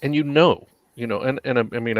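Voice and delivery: ordinary talk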